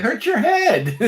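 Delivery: laughing tease. Real, not acted. Co-host joking while laughing.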